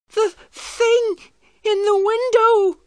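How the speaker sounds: high, loud, slow frightened wail; terrified boy crying out. A narrator's reading. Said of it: "He was crying".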